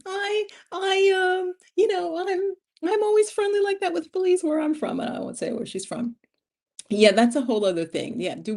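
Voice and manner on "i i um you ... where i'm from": much higher, sheepish voice for her friend; a high, innocent excuse from a friend; imitating friend. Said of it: "and she's like".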